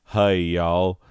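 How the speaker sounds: loud Southern y'all greeting; deep south accent. Shown as a demonstration. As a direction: greet them like a good ol' boy. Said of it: "I'm gonna do this voice".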